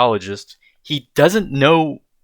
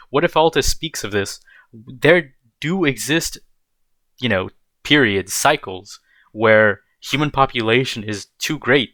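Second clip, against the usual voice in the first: ordinary talk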